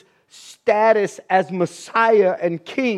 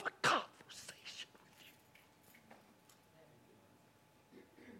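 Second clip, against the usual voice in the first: whisper; 10 semitones lower, 27 dB quieter than usual. Acted.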